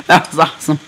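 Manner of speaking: usual speaking voice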